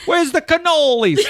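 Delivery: high, hammed-up New York accent. Cracking up his co-host with a hammed-up New York accent. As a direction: ham up a New York Italian dad. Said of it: "I don't know why I did that".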